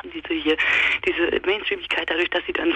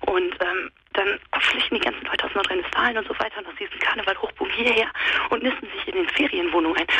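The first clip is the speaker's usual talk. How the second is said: ordinary delivery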